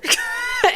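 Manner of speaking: cracks up at the phrase; delighted laugh; big burst of laughter. Real, not acted. Laughing at be the bus.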